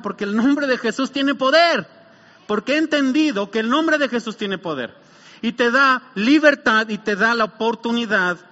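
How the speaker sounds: forceful pulpit declaration; impassioned preaching. Acted. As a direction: proclaim it with drawn-out conviction.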